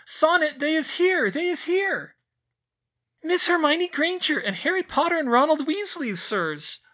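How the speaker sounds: very high, loud squeak; a tiny house-elf squeaking a greeting; squeaky elf. A narrator's reading. Elf greeting the children in a high squeak. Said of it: "Sonia squeaked".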